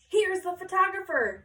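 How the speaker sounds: teacher announcing the photographer. Acted. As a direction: call out the news brightly. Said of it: "Miss Shepherd announced".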